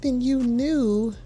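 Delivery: exasperated sassy reprimand; scolding. Real, not acted. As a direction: lecture her like she should know better. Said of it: "commentator scolding cast member".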